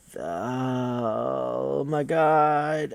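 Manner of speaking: drawn-out weary oh my god; exasperated. Real, not acted. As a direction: sigh it out slowly. Exasperated groan.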